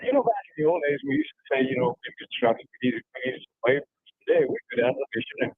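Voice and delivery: choppy cutting out cell signal; breaking up phone line. Real, not acted. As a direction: voice dropping in and out on a bad line. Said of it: "your signal's bad or something".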